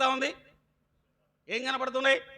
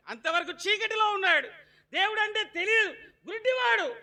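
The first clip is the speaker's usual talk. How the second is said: high preaching; 12 semitones higher, 4 dB louder than usual. Acted.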